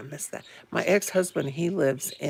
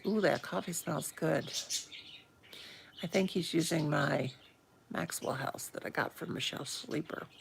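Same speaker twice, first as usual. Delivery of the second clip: ordinary talk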